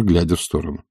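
ordinary delivery, nothing marked

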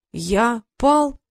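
hurt, disbelieving little question; offended question. A narrator's reading. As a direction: ask it as if personally slighted. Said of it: "обиженно сказал Иен".

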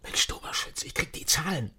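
hushed, conspiratorial whisper. Acted. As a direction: lean in and whisper the secret. (verschwörerisch geflüstert)

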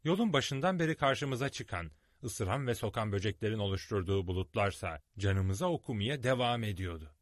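ordinary delivery, nothing marked

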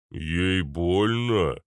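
a hint of slow, dull giant; dim giant's bass. A narrator's reading. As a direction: drag it out like a slow-witted giant. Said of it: "послушался бас недоразвитого гиганта". (медленный голос недоразвитого гиганта)

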